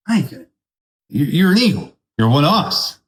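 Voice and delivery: lifted eagle voice, coaxing; high eagle voice. Acted. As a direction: tell the chicken it belongs with us. Eagle answers chicken.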